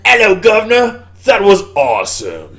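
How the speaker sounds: loud, high-pitched mock-Cockney greeting; over-the-top British accent, drawn out at the end; hammy English accent. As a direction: put on a cartoonish English accent. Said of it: "then he goes back in".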